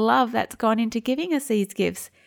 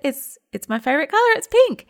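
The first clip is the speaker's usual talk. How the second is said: forced cheer; 6 semitones higher, 5 dB louder than usual. Retold or imitated. Mum answers husband about bottle.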